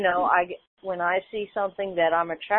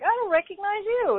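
surprised; 13 semitones higher than usual. Retold or imitated. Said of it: "And I was like".

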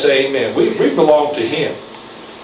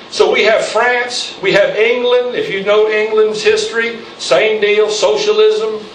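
higher, slower, emphatic preacher's list; preaching at full force; 5 semitones higher than usual. Real, not acted. Preacher warning his congregation about Europe, full-force preaching. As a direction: hammer each nation like a charge.

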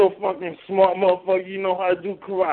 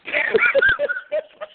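very high-pitched laugh. Real, not acted. Teaser cracking up after gorilla insults, high squealing laugh.

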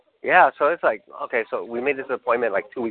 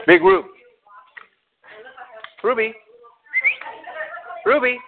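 calling out; 12 semitones higher than usual. Real, not acted. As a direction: call her from across the yard. Calling the dog Ruby.

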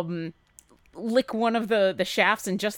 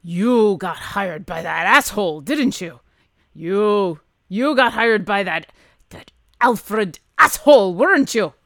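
louder, gruff dwarf voice with bitter emphasis; accusing gruff dwarf; 7 dB louder than usual. Acted. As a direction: accuse them as an affronted dwarf.

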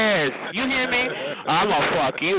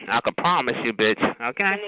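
usual speaking voice

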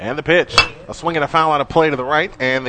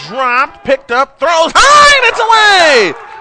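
excited call; 11 semitones higher, 10 dB louder, slower than usual. Real, not acted. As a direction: pitch climbing into a shout. Error on a throw to first.

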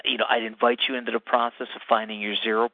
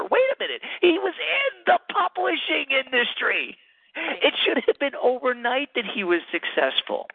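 mock disbelief; 14 semitones higher than usual. Mock disbelief about overnight success.

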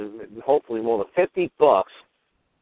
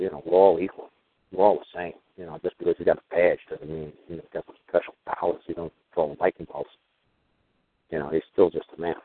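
dad's advice; 7 semitones lower than usual. Retold or imitated. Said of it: "my dad told me".

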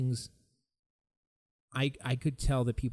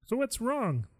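high-pitched mock concern; prying questioner; 10 semitones higher, much slower than usual. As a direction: imitate someone coming up to pry. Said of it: "come up to you and say".

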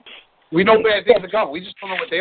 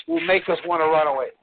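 normal talking voice

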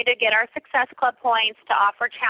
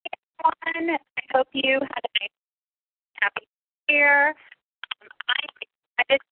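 breaking up phone line; 4 semitones higher than usual. Real, not acted. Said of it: "You're breaking up just a little bit".

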